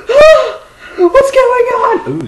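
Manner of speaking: very high, loud panicky squeal; mock scared scream. Vlogger re-enacting the screen going blank, high mock-scared scream. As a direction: squeal in exaggerated fright. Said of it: "I got scared".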